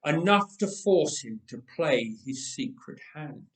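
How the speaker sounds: ordinary delivery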